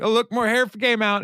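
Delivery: high, crying shout about the hair; mock panicked cry. [name] shrieks to Leslie as hair comes out, crying. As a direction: wail it in a hammed-up panic.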